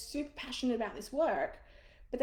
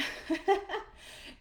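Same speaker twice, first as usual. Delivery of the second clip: light airy laugh; amused laugh at herself; high breathy laugh; 10 semitones higher than usual. Real, not acted. Laughs after refusal.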